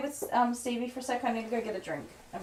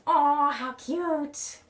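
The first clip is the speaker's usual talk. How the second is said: cooing; 8 semitones higher, much slower than usual. Real, not acted. Cooing over a dog photo.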